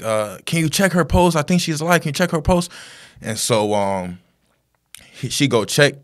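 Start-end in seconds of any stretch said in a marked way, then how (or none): 0.5-2.7 high-pitched urgent plea; acted desperation, voice raised high; retold pleading. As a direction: imitate a frantic young man begging for help. Acting driver begging for help.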